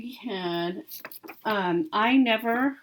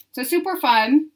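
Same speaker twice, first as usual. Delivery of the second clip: bubbly; 7 semitones higher, 5 dB louder than usual. Real, not acted.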